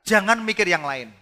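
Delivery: loud, high, slow preaching; forceful preaching. Acted. Preacher urging the congregation to focus, loud and high. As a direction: drive it home to the congregation.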